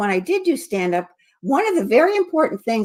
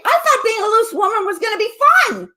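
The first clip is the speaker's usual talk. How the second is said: loud, high punchline; stand-up punchline; 12 semitones higher, 3 dB louder than usual. Shown as a demonstration. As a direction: belt out the joke line. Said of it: "I used to say".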